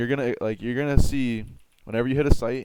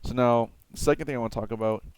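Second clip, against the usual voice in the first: ordinary delivery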